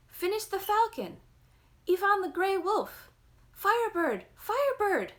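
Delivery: put-on high, loud clamoring kids; children's clamor. A narrator's reading. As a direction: shout it like excited children all at once. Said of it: "set up a clamor".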